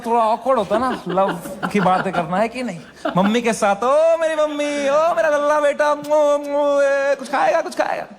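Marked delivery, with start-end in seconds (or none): 3.8-8.1 put-on high, sing-song baby talk to mom; doting coo. Son and mother cooing lovingly, doting baby talk. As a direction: coo like pampering a child.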